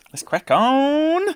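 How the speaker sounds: excited call. Real, not acted. Starting the golf round.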